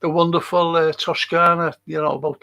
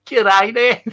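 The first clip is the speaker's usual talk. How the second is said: louder, higher mock Australian-Brummie greeting; Australian-Brummie accent; 6 semitones higher, 6 dB louder than usual. Shown as a demonstration. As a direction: call out g'day in Australian-Brummie. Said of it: "Does that sound Brummie to you?".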